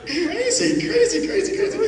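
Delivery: high, giggling crazy crazy; amused squeal; laughing. Real, not acted. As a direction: laugh through each crazy. Hypnotist marvels, laughing.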